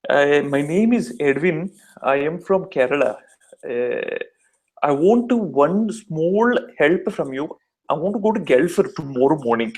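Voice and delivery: slow, thick Kerala-accented English. Shown as a demonstration. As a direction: draw the words out like a South Indian from Kerala. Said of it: "down south in Kerala, they're like".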